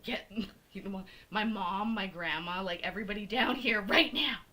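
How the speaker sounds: loud retold rallying call, building; urgent summons. Acts out summoning family by phone.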